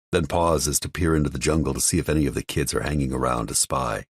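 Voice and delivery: ordinary delivery, nothing marked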